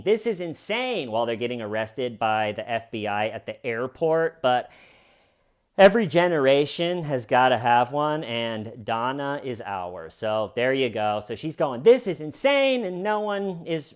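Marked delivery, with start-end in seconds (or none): 0.0-1.1 high-pitched mock outcry; wild claim. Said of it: "wildly claiming". 11.8-12.8 very high, loud imitation of her outcry; mock outrage. Donna's outcry that nobody answers.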